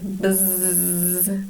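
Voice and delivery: soft low buzz; bee buzz. Acted. Making the bee noise for the children, soft buzz.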